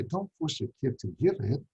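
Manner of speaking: normal talking voice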